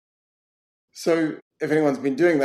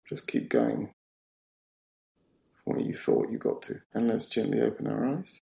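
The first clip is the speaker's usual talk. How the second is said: hushed, low meditation voice; guided meditation; 3 semitones lower, 5 dB quieter than usual. Acted. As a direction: guide them softly into silence, leaving pauses. Leads a closed-eye meditation.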